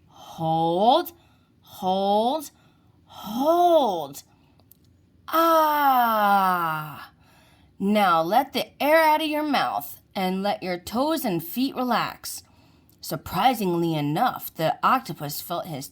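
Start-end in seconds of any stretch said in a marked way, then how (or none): 0.1-4.2 strained hold building to a loud peak. Acted. Holding the squeeze tight. 5.3-7.1 a big open "ah" of release after the squeeze; loud, relieved ah as the tension drops; relieved loud exhale. Acted. 7.8-12.4 put-on calm, even coaching voice leading a child's relaxation; soothing relaxation script read aloud; guided relaxation. Sea child leads relaxation.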